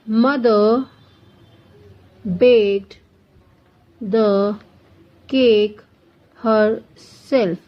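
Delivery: slow, each word set apart by long pauses; word-by-word dictation. Shown as a demonstration. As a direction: dictate it one word at a time for students to write. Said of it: "teacher dictates example sentence".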